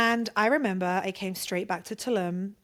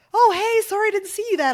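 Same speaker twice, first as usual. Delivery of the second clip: high, loud fake-casual "oh hey"; mock surprise; 11 semitones higher, 8 dB louder than usual. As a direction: pretend you just noticed him, much higher and louder. Faking surprise at meeting the ex.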